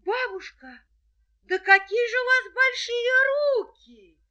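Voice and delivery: put-on high, loud amazed girl; wide-eyed little girl. A narrator's reading. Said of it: "и говорит".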